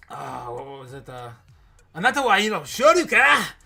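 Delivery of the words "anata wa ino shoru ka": loud, hammed-up Japanese phrase; mock Japanese. Streamer imitating Japanese traders, loud hammed-up Japanese. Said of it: "is what I'm saying in Japanese".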